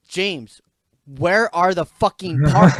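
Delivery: loud, drawn-out angry demand; retold exasperation. Retold argument with a coworker, escalating.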